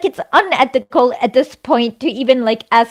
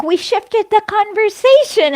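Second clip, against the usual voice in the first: mocking quote; 8 semitones higher than usual. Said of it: "And people were like".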